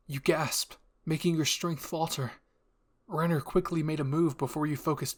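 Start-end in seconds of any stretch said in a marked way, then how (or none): none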